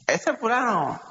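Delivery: lightly mimicked grandmother, pitched high; high, mocking jab at a passer-by; grandmother's gibe. Preacher quotes his grandmother about a fellow, mocking. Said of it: "lo usaba mi abuela mucho".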